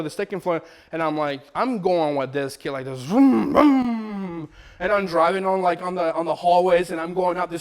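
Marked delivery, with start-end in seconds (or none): 3.0-4.5 loud engine revs, climbing in pitch; imitated car revving. Father driving his newborn down hallways, imitating engine revs. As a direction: rev loudly like a car taking off. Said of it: "sound of a car".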